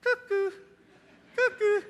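mock a crazy person with a high cuckoo; cuckoo call. Preacher mocks the cheese-moon believer with a high cuckoo.

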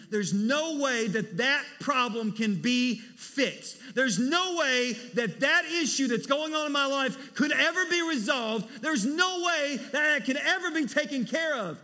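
loud, high, anxious wail of despair; mock panicked worry. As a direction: ham up a freaking-out believer, loud and high. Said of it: "because we're saying".

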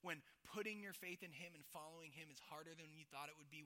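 ordinary talk, nothing marked

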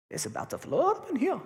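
mock alarm. Joking that a flood is coming.

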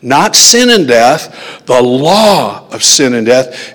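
slow, emphatic contrast landing on "law"; stress on law. Retold or imitated. Preacher clarifying the verse, stress on "law". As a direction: draw out "law" to set it apart. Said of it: "it emphasized the word law".

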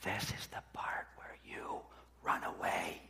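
soft menacing whisper; ogre's hushed warning whisper. Retold or imitated. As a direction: lean in and whisper the threat. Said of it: "and he whispers".

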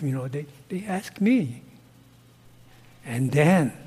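usual speaking voice

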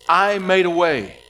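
loud, slow and emphatic; proclaiming loudly. Acted. Preacher voicing God's promise to the congregation, loud and slow. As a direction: ring it out as a bold promise.

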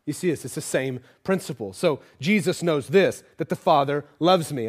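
ordinary talk, nothing marked

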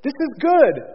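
high, loud admiring declaration; God pleased with creation. Acted. Voices God admiring his creation.

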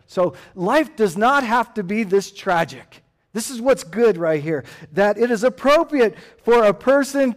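loud, rising sermon voice calling for amen; full-force preaching. Acted. Pastor urging the congregation to enjoy life, preaching loudly. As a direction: drive the point home from the pulpit.

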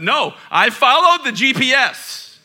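high, loud mock protest; mock indignant. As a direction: act outraged at yourself. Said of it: "mock indignant defense".

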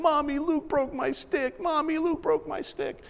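put-on high, slow whiny child voice; mock-tearful kid pleading with mommy; imitating crying little girl. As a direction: play the wailing little daughter. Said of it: "she's crying".

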